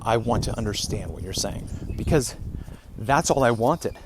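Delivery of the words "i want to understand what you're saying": quiet, softer, almost whispered self-coaching; soft advice to himself. Retold or imitated. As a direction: murmur it like a voice on your shoulder. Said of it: "I'd whisper on my shoulder".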